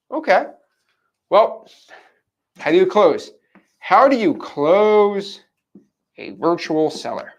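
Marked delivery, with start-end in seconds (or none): 3.8-7.3 very slow, stretched-out words; dramatic drawn-out question. Real, not acted. Trainer poses the big closing question to viewers, dramatically drawn out. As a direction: draw out every word for suspense.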